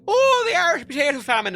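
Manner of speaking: loud, very high-pitched jeering taunt; shrill singsong mockery of an Irish kid; mocking classmates. Classmates taunting the only Irish kid in class, mocking. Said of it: "everyone mocking me".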